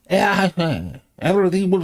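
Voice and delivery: mock staunch type. Mocking Victorian hypocrisy, a hint of staunch bluster. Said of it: "this staunch".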